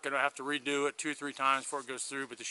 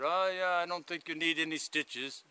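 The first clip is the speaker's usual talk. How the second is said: imitating a German accent, pitched up a little; quoting her in a higher German-accented voice; 3 semitones higher than usual. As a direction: lightly mimic a woman's German accent. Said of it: "she had a German accent".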